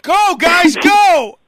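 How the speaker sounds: high, loud cheer to start; mock hype shout. Host pretending to cue a live band, excited shout. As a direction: yell them on like a live show.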